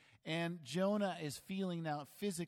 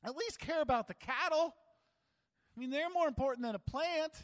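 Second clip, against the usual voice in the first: exasperated rebuke; 11 semitones higher, 7 dB louder than usual. Retold or imitated. God's zinger to pouting Jonah.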